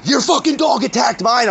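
high, indignant mock yell; imitated angry accusation. Acts out the other owner's accusation.

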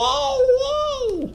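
very high, wailing whoa; frightened shriek. A narrator's reading. The lamp panics at lightning, very high wail.

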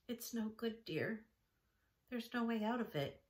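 soft, gentle comfort to a little sister. A narrator's reading. Esther gently tells Judy there's no way out, softly. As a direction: ease the blow kindly and softly. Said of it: "she said gently".

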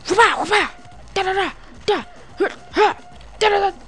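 high-pitched whoops and strike syllables; mock martial-arts noises. Player fighting five enemies, playful kung fu cries. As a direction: voice every move up high. Voicing fight moves in game.

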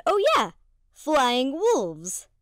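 ordinary talk

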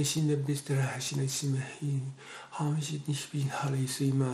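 soft, rhythmic babble of repeated syllables; speaking in tongues. Real, not acted. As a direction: let the tongues flow in a quiet repeating stream. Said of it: "让各位弟兄姐妹看看什么是真的方言".

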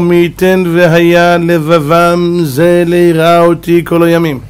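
slow, drawn-out level intonation; solemn recitation. Acted. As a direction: intone each word at length. Quotes God's wish, solemn chant.

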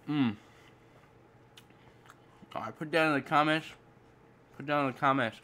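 chewing while talking; talking between bites; mouth full. Real, not acted. Seen 2: Bites green apple at -7s; lips closed, chewing motions while speaking.

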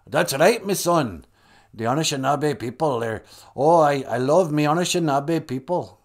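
lightly put-on accent; mild mock accent for a deity; Kichimanadu. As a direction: play an affectionate god with a comic accent. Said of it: "not sure why he has that accent".

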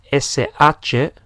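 ordinary talk

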